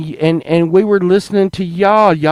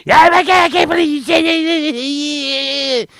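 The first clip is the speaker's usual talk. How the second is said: much higher, slow, shrill-free imitation of the angry lady; high mock lady voice; 12 semitones higher than usual. Mocking the lady who yelled at him, in a high voice. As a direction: play the offended woman, high and drawn out. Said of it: "She's like".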